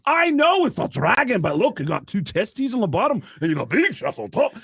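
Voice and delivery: excitable high rock singer voice. Acted. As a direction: start high and insistent, then point it out. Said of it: "He goes".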